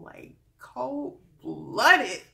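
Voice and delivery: cold-blooded, said slowly with a laugh. Real, not acted. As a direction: stretch it out slowly through a laugh. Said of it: "mock outrage then laugh".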